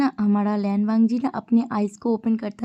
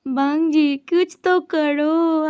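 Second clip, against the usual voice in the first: higher, louder, very slow mock-tearful begging; hammed-up crying plea; 5 semitones higher, 3 dB louder, much slower than usual. Brother clinging to Wangji, melodramatic fake-crying plea. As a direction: overdo the tears like a drama queen. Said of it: "पकड़ के रो रहा है, I mean, नौटंकी कर रहा है".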